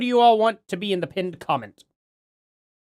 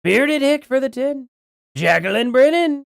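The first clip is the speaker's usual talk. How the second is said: Donald Trump; 6 semitones higher than usual. Acted. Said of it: "in a Trump accent".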